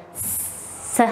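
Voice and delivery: long unvoiced "s"; isolated S sound. Shown as a demonstration. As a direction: hold the "s" as a sustained hiss. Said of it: "the sound".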